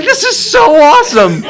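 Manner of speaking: high, loud gushing; excited fan. Retold or imitated. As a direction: imitate a thrilled fan. Retold thrilled fan reaction.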